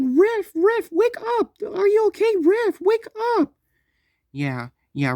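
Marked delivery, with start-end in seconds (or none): 0.0-3.5 high, loud, desperate cries to wake him; frantic screaming. Retold or imitated. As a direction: shriek his name, begging him to wake up. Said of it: "in the background screaming Riff's name".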